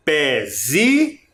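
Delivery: slow Pezinho, split pé, zi, nho; syllables apart. Shown as a demonstration. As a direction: say Pezinho piece by piece. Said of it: "que o pé é a sílaba mais forte". (Pezinho devagar, sílaba por sílaba)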